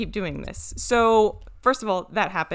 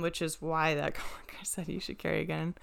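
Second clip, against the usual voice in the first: drops to a whisper for the gun bit; a sly whispered aside to her co-host; 2 semitones lower, 9 dB quieter than usual. Real, not acted. Whispers to the co-host about carrying a gun.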